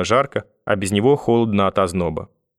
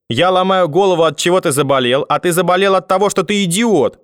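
high-pitched, exasperated scolding; grandmother's angry tirade; 9 semitones higher, 4 dB louder than usual. A narrator's reading. Grandmother scolding her grandson for falling ill, angry tirade. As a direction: berate the child, pitch climbing high. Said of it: "закричала бабушка".

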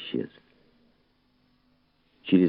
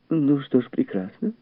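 high, light female character voice; high-voiced lady making small talk; chatty society woman; 10 semitones higher than usual. A narrator's reading. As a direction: play an agreeable lady guest, pitched up.

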